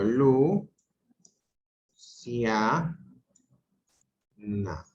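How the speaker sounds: "Lu", "Cia", "Na" said apart, each syllable alone with long pauses between; split into syllables. Shown as a demonstration. Teacher splits Luciana into syllables for the class, slowly and distinctly. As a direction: break "Luciana" into "Lu", "Cia", "Na", pausing after each. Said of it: "Vamos a separarlo por sílabas".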